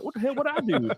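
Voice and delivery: high-pitched, baffled protest; retold bewilderment. Reacting to hate messages, in high mock bewilderment. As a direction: act out baffled innocence, voice jumping up.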